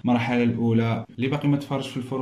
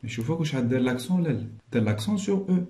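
ordinary talk

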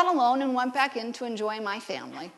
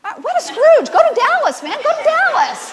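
laughing tease; 14 semitones higher, 11 dB louder than usual. Real, not acted. Teasing congregant Anna.

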